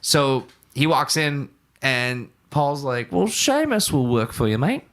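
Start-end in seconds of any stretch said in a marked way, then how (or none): 3.1-4.8 higher-pitched, badly imitated accent of a bandmate; hammed-up, clumsy stab at his accent; [name]'s accent. Musician agreeing to lend out the young worker, terrible imitated accent. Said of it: "imitation of his accent".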